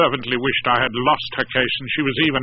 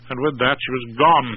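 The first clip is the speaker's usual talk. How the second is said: ordinary delivery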